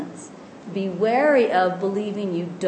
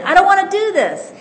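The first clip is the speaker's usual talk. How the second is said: mock yell, much higher and louder; shouting again; 12 semitones higher, 9 dB louder than usual. Teacher repeating the shout into the cave, loud mock yell. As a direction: belt it out like a child refusing. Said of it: "you shout it again".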